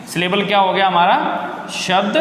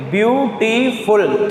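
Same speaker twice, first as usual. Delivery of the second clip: Beautiful very slow, as view, टी, फुल with gaps; three separate pushes on view, टी, फुल; syllable-by-syllable; 2 semitones higher, much slower than usual. Shown as a demonstration. Teacher showing three pushes on the palm, syllable-by-syllable. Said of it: "तीन बार आपको ताकत लगेगी".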